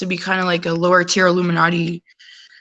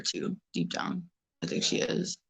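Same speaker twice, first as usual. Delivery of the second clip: hushed whisper, confiding; whispered aside; 13 dB quieter than usual. Real, not acted. Confiding about her mom being a flat earther, whispered. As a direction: drop to a secretive whisper.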